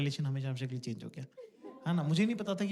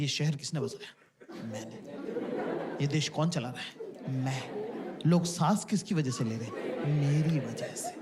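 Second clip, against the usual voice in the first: lightly voiced dad, low pitch; low, self-satisfied answers to his own questions; self-important dad; 5 semitones lower than usual. Comic playing dad alone before the TV, lightly self-important. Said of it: "पापा लोग की TV कैसे देखते हैं".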